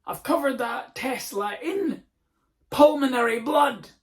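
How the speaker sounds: over-the-top fake emergency; mock panic. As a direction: wail like your arm fell off. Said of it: "go mental".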